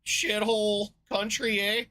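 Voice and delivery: mock Canadian, high and slow with a loud eh; Canadian accent. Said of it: "Or that's Canadian, isn't it?".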